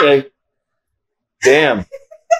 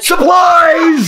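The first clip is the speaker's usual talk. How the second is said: sudden high shout; 4 dB louder than usual. Acted. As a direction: jump out shouting, high-pitched. Janitor bursting from closet.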